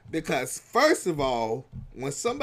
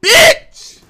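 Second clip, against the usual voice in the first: mock insult demo; 12 dB louder, much slower than usual. Shown as a demonstration. Showing how to make the insult ring, ending in a loud yell. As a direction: stretch it out then yell the end. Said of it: "add some adjectives".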